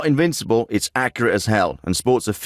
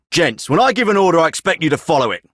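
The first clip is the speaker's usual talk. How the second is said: raised, higher-pitched reprimand; stern rebuke; 5 semitones higher, 4 dB louder, faster than usual. Acted. Commander scolds his men for ignoring an order, stern and raised. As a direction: lay down the law, louder.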